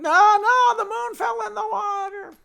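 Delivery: very high, loud hammed-up wail; mock crying. Chelm villagers refuse to believe it's a reflection, mock crying. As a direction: cry out in shrieking mock despair. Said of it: "and they're crying".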